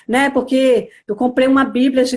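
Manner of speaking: ordinary talk, nothing marked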